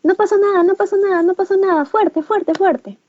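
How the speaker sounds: high, brisk mock reassurance brushing off the fall; dismissive parent. Shown as a demonstration. Parent lifting fallen child, waving it off in a high brisk chirp. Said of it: "Y le decimos".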